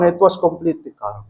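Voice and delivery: normal talking voice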